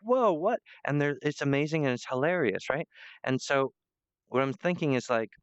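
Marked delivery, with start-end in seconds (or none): 0.0-0.6 high-pitched astonished whoa; amazed audience. Acted. Acting out the audience's reaction to a guest director, amazed. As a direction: react like a stunned viewer. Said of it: "People are like".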